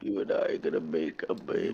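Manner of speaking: deep seductive voice. Retold or imitated. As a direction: croon it low and smooth.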